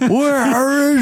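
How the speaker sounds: high, drawn-out bellowing wail; [name]. Acted. Game master as a giant hunting for her, drawn-out bellow. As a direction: stretch every word into a hammed-up shout. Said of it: "as you just hear, uh, [name] go".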